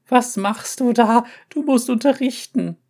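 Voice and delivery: high loud panicked hiss. A narrator's reading. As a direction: yelp it in panic. Cat panics loudly.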